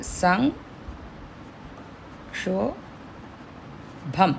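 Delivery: సంక్షోభం whispered very slowly, the ం as ఙ; whispered velar ng. Shown as a demonstration. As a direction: breathe out సంక్షోభం slowly, ng in the throat. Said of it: "ఇంగ్ లాగా రావాలి". (సంక్షోభం ను గుసగుసగా, ఙ తో నెమ్మదిగా)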